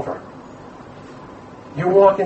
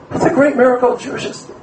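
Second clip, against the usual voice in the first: quick and raised, proclaiming the miracle; fast excited proclamation; 9 semitones higher, faster than usual. Shown as a demonstration. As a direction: rush it out high with wonder. Phrase repeated, first take heightened.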